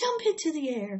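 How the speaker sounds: high, loud command; crying out. A narrator's reading. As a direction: cry it out like a coach. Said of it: "cried Charlotte".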